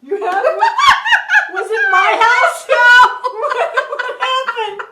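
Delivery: high, loud astonished shout through laughter; gasping in delighted disbelief; shocked laughing. Real, not acted.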